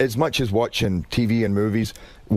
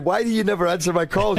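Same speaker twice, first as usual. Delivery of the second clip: high-pitched nagging wife; mock wifely complaint, pitched up; complaining wife; 9 semitones higher than usual. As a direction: play the wife demanding an answer. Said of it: "His wife said".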